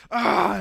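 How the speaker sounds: loud, high cry of sadness and anger; acted anguished cry. Lost hiker finally reaching his friends, acted anguished cry. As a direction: burst out with pent-up emotion. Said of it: "just sadness and anger".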